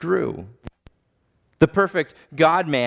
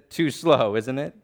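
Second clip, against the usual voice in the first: normal talking voice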